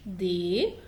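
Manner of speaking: ordinary talk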